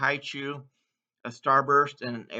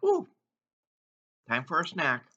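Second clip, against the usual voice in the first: pretend delight. Imagined snack-time exclamation.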